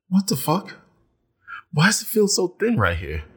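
high-pitched, baffled reenactment; puzzled shock, replayed high; retold disbelief. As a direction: act out alarmed discovery, pitch up. Acts out discovering thinning hair.

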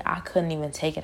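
ordinary talk

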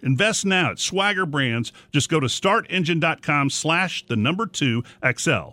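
upbeat commercial voiceover; ad read. Acted. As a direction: read it like a radio ad. Recorded ad read.

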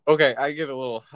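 normal talking voice

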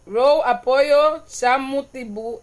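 Row drawn out slowly and loudly, then Chamutibu sounded out; slow careful reading. Shown as a demonstration. Streamer rereading a viewer's Garífuna comment aloud, slowly. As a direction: spell out the Garífuna words slowly. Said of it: "vamos a volver a decir aquí".

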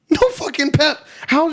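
high, loud disbelieving blurt; frustrated outburst. Retold or imitated. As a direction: blurt it out, fed up and incredulous. Retold outburst after long silence.

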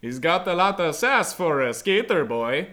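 loud, oddly put-on vampire accent; badly faked vampire, projected loudly; failed vampire voice. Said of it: "what was that voice?".

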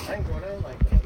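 loud defiant declaration. Real, not acted. Telling the listener he won't be taken, loud and defiant. Defiant vow against being killed.

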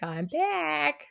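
high, soft mock creep; playful creepy return. Teller jokes as the returning date, high and soft.

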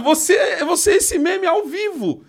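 laughing out the words, pitch way up; teasing laugh; cracking up while teasing. Real, not acted. Laughing while mocking friend.

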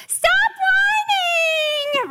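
drawn-out high shout, slow; hammed-up shriek at the kids; mock yell. Mom yelling at her whining little ones. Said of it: "when I had whining little ones to go".

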